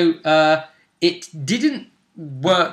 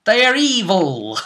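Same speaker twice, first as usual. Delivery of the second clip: mock ominous; 6 semitones higher, 3 dB louder than usual. Mock-villain declaration about politicians.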